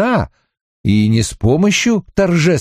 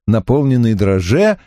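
ordinary delivery

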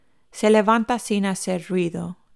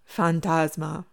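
laughing; slower than usual. Acted. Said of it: "ríe la voz de Jess".

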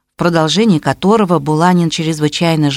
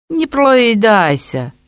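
lazy drawl; 6 semitones higher, much slower than usual. A narrator's reading. Arap's lazy retort.

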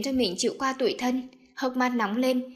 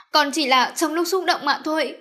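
higher, louder, crying plea; tearful; 5 semitones higher, 5 dB louder than usual. Acted. Daisy crying as she excuses herself. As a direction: plead through tears. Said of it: "Cô khóc".

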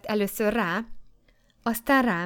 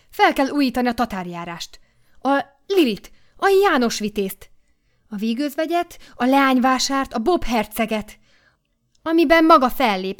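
louder, higher, eager proclaiming; exuberant enthusing; 4 semitones higher, 6 dB louder than usual. A narrator's reading. The editor promising Kata a career, loud and eager. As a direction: sell the dream with rising fervour.

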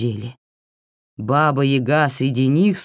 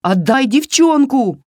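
high, forceful cry; high-pitched insistent yell; shouted demand; 10 semitones higher, 4 dB louder than usual. A narrator's reading. Varya demands the child back.

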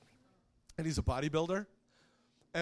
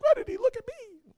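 imitating her; 8 dB louder than usual. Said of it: "She's like".

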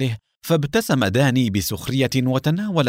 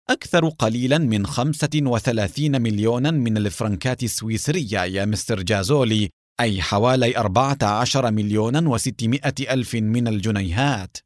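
ordinary delivery, nothing marked

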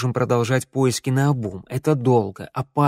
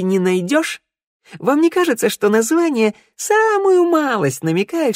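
much higher, incredulous mocking voice; sarcastic disbelief; 9 semitones higher, 3 dB louder than usual. A narrator's reading. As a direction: pile on the sarcasm, pitch climbing high. Shnyryala scoffs at the plan. (язвительно, с издевательским недоумением)

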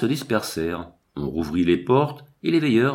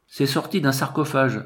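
raised-pitch, insistent statement; firm assertion; 5 semitones higher than usual. A narrator's reading. Southern guard tells his chief, firmly asserting. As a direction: state it as plain fact, higher. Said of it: "affirma le méridional".

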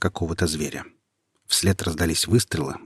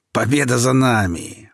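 loud, slow triumphant crow; gloating bandit; 5 semitones higher, 5 dB louder than usual. A narrator's reading. Bandit ruffles the boy's hair, loud gloating crow. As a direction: crow over the kill. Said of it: "рассмеялся незнакомец".

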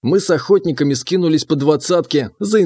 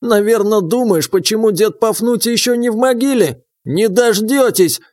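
put-on higher, defiant old man; old prospector; 6 semitones higher than usual. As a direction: crow it like a feisty old-timer. Old man Pafnutiy voiced.

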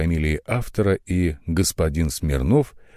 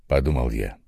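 ordinary delivery

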